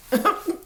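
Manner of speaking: short, high burst of laughter. Real, not acted. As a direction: let out a sudden real laugh. Amused by left-at-altar reference.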